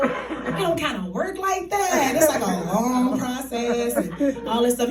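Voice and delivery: slow, drawn-out brush-off in imitation; reluctant letdown. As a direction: stretch it out, letting someone down awkwardly. [name] reluctantly explains the process.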